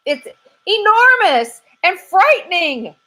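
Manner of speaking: high, loud squirrel voice, dragging out scary; a jittery squirrel spooked to the bone; scared squirrel. Acted. Squirrel telling the detective about the monster, high and loud. Squirrel tries new words.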